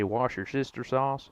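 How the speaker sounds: Worcestershire sauce as Wash your sister sauce, stressing sauce; mock American Wash your sister sauce; American mispronunciation. Shown as a demonstration. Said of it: "we just say".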